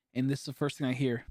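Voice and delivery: usual speaking voice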